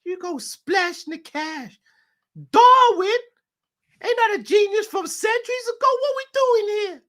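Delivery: high squeaky put-on owner voice, hammed up; J W Henry impression. Owner ranting about spending all his money, in a squeaky high mock voice. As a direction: whine about your money in a silly high voice. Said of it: "his J W Henry impression".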